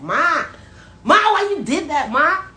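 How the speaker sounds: loud, high calls to his mother; shouting at mom. Real, not acted. Son shouting at his mother in disbelief, loud. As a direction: yell it across the house at Ma.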